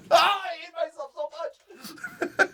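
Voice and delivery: high, drawn-out laughing wail; comic self-loathing. Real, not acted. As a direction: wail in mock despair, half laughing. Comic self-loathing after own bit.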